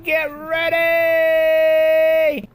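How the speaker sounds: slow drawn-out shout. Acted. Calling out to son.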